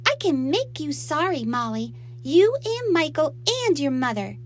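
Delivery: put-on high, loud child's threat; threatening little girl. A narrator's reading. Little girl threatening her stepfamily, spitefully.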